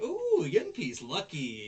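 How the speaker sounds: high, loud, drawn-out cooing glee; mock delight. Onizuka spotting a coin on the ground, mock delighted. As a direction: coo greedily over a lucky find. Retold delight at finding money.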